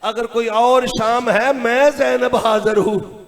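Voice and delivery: normal talking voice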